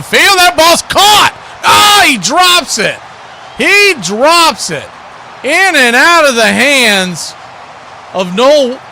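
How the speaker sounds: high, loud call of a big play; excited play-by-play. Real, not acted. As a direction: shout the catch, then stretch out the dropped ball. Live call of a dropped catch.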